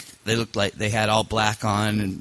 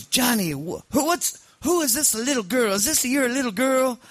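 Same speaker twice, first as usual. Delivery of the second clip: high-pitched mock Sicilian accent; Sicilian mob boss; 13 semitones higher than usual. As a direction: play the little old Sicilian don, voice pitched way up. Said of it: "Sicilian guy in the middle".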